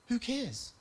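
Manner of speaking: high, incredulous who cares; exasperated who cares. Retold or imitated. Preacher retelling his reply to a pastor, high and incredulous. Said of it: "I was like".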